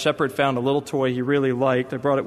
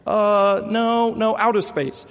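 put-on higher, earnest little boy explaining; voicing his son; 6 semitones higher, slower than usual. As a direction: play a small boy reassuring his sister. Son corrects dad.

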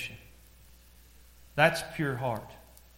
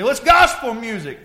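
high, loud imitated objection; acted-out loud justification; mock excuse; 8 semitones higher, 11 dB louder than usual. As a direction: belt out the excuse defensively. Acts out a listener's excuse.

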